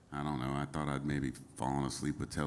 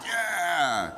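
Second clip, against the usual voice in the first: excited; 12 semitones higher, 8 dB louder than usual. Retold or imitated. Agreeing eagerly while writing.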